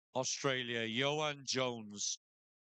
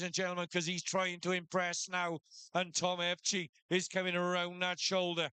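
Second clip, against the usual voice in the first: shearing commentator; 4 semitones higher than usual. Acted. Live shearing play-by-play.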